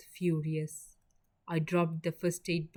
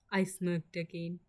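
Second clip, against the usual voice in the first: ordinary delivery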